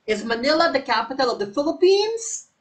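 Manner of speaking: yes-no question rising at "Philippines"; rising intonation. Shown as a demonstration. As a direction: lift the tune on "Philippines". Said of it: "the correct answer is rising intonation".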